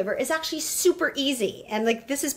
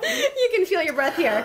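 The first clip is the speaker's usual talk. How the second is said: laughing; 9 semitones higher than usual. Real, not acted. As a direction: laugh through the words.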